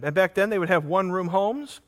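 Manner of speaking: ordinary delivery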